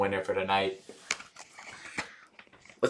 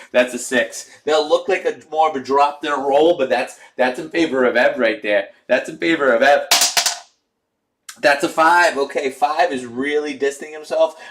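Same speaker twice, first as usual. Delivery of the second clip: louder, lively play-by-play call; race commentary; 3 semitones higher, 4 dB louder than usual. Acted. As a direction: call the race like a track announcer. Calls the horse race live.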